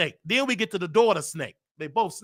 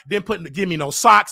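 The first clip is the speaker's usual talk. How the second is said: ordinary delivery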